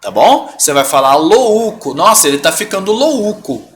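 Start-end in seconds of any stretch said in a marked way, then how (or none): 1.1-1.9 louco as "lo-Ú-co"; changed pronunciation. Shown as a demonstration. Teacher showing the mistake, louco wrongly said lo-Ú-co. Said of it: "você vai mudar a pronúncia". 1.9-3.6 louco as "lo-Ú-co" at the end; lively sentence with louco stressed on a separate Ú; wrong pronunciation in use. Shown as a demonstration. Teacher showing nobody talks so, louco said lo-Ú-co. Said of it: "Ninguém fala assim".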